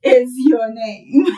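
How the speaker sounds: mock the silly word-for-word question, laughing. Teacher laughing at a wrong literal translation. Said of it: "sounds a bit weird".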